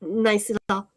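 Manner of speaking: ordinary talk, nothing marked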